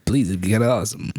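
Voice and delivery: normal talking voice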